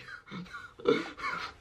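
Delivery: a short, breathy laugh at own joke; soft laugh at own joke. Real, not acted.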